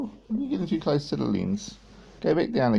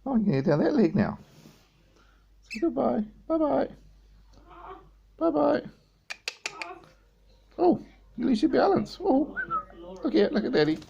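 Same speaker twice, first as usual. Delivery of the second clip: squeaky high hello to the bird; 13 semitones higher than usual. Real, not acted. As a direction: chirp it up high for the pet. High pet talk.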